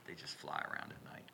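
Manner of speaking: unvoiced joking whisper; whispered aside. Real, not acted. Preacher whispering a joke aside to the congregation.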